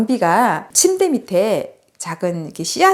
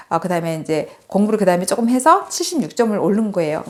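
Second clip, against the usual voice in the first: ordinary talk, nothing marked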